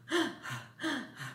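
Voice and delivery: soft panting gasp for air; breathless gasp. Acted. Bunny home after fleeing, out-of-breath gasp.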